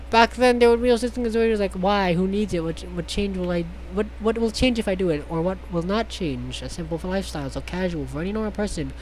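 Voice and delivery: ordinary talk, nothing marked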